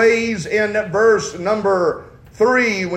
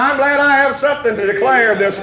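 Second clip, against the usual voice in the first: fiery preaching; 4 semitones higher than usual. Acted. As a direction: preach it full force to the congregation. Preaching at full force.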